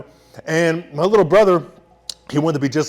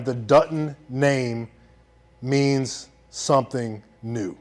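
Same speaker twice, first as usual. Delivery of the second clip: slow, softer, heartfelt; slow sincere; 4 semitones lower, 5 dB quieter, much slower than usual. Real, not acted. Closing his speech about family, slow and sincere. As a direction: let the words land slowly.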